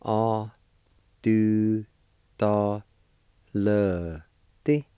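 "A do ta le ti" as isolated, held syllables; syllable-by-syllable pronunciation. Shown as a demonstration. Teacher showing learners "A do ta le ti" syllable by syllable. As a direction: pause after each syllable of "A do ta le ti". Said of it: "is pronounced like this".

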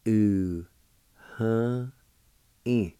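"Ooh, huh, in." each syllable said separately; syllable-by-syllable demo. Shown as a demonstration. Teacher splitting the word into syllables for the class. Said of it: "It's pronounced like this".

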